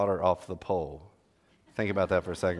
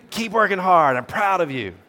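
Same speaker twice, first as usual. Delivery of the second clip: cheering dad; 8 semitones higher, 9 dB louder than usual. Shown as a demonstration. Dad praising a C student.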